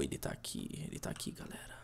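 hushed whisper, then shushing; librarian shushing whisper. Pretend. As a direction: whisper so the shark won't notice. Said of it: "Faça um silêncio".

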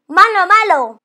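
loud, high voice of a scolding dog. Acted. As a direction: scold the robber loudly.

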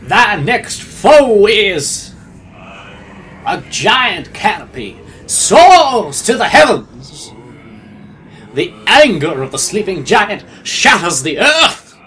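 slow, loud, booming proclamation; preacher voice. Pretend. Player reads out the next foe to the others, preacher voice. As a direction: thunder it out like a preacher, word by word. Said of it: "my preacher voice on".

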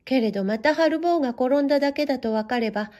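ordinary delivery, nothing marked

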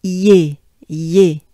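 "Ye, ye" with the e punched loud; stress the e. Shown as a demonstration. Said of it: "so you accentuate the E".

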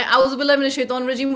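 fast mock yell of the prayer. Mother yelling the prayer at her kids, in a mock yell. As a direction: rattle it off in a half-yell. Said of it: "was like I was yelling".

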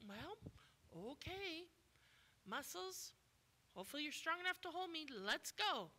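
light Jesus voice. Acted. Jesus voiced in sermon story.

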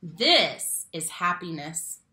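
ordinary delivery, nothing marked

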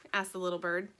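ordinary delivery

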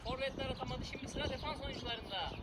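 soft play-by-play voice; hushed commentary. Acted. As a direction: whisper it like a golf commentator.

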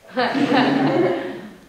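high, loud burst of laughter; embarrassed laugh. Real, not acted. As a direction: crack up at your own confession. Laughs after rude confession.

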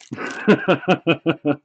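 quick rolling chuckle; laugh. Real, not acted. Cracking up at his own story, laughing. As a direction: chuckle in a run of short bursts.

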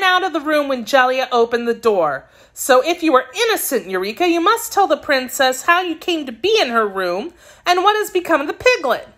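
put-on high girlish voice, pressing her case; a child's high, urgent lecture; scolding little girl. Girl demanding the truth from her kitten, scolding.

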